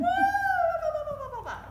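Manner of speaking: squeaky high bababa babble like cooing at a pet; high baby-talk babble. Shown as a demonstration. Coach demoing how you talk to a little dog, high cooing babble.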